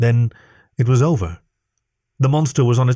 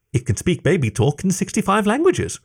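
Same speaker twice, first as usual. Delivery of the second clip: hopeful; 8 semitones higher than usual. Acted. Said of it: "he said hopefully".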